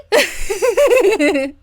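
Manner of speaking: bright burst of laughter; loud high giggle; delighted laugh. Real, not acted. Delighted at gift joke.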